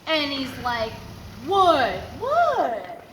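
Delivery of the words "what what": rising, louder guilty "what"; imitating a guilty dad dodging; playing innocent. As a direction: fake innocence, each higher. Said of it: "That's what my dad does when he's guilty".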